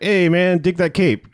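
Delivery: lightly hammed-up admirer hailing someone; mock Skillet greeting. Skillet greets Blackula about his cape, mock jive greeting.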